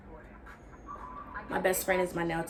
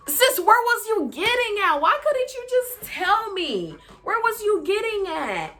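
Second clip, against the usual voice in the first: high, exasperated whine at a friend; exasperated pleading; 13 semitones higher, 3 dB louder than usual. Retold or imitated. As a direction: plead with your friend to just spit it out. Exasperated replay of friend's evasive questions.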